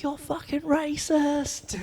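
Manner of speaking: a mocking child yelling from the crowd, drawn out; heckling kid. Kids heckling a comic bombing on stage, high slow jeer. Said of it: "kids heckling and being like".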